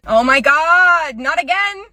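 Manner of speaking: high, loud, drawn-out groaning words; hammed-up "here we go" despair; exasperated dismay. Store worker watching a child wreck the display, exasperated.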